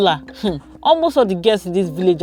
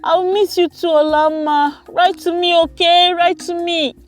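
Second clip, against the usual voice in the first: high-pitched, pleading goodbye; sad farewell; 10 semitones higher than usual. A narrator's reading. Cousin left behind in the village, high sad goodbye. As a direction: plead high to stay in touch. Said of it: "Chizoba, feeling sad".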